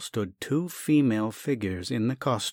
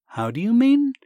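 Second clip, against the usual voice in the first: much higher, louder startled question; 13 semitones higher, 8 dB louder than usual. Acted. Sasha startled at the doctor's refusal, blurting the question. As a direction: blurt it out, taken aback.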